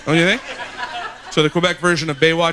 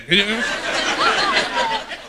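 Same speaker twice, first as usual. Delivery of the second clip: high squealing laugh. Real, not acted. Loud laugh after joke.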